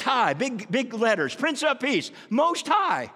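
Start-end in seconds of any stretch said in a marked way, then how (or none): 2.3-3.1 higher, loud cry of praise; preacher shouting the holy name; shouted praise. Real, not acted. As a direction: proclaim Most High with a shout. Preacher exalts the Most High.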